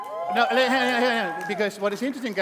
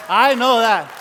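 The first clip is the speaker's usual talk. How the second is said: louder punchline; 3 semitones higher, 10 dB louder, slower than usual. Real, not acted. Joke about being sexiest.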